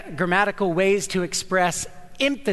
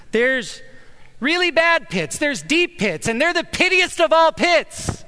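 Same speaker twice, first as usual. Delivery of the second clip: climbing higher and louder on each phrase; building emphasis; 9 semitones higher, 3 dB louder than usual. Shown as a demonstration. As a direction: pile it on, bigger each time, pittiest of all. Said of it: "what the writer is saying is".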